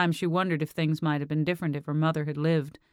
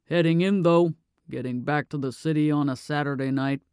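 a hint of a grumbling old dad, louder at first; lightly voiced gruff father; 3 dB louder than usual. A narrator's reading. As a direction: grumble it like a father who worries about the drive.

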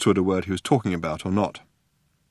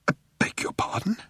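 breathless, much higher spluttered question; 12 semitones higher, 4 dB quieter than usual. A narrator's reading. Arthur spluttering in shock at the name. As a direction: choke it out in disbelief. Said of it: "he spluttered".

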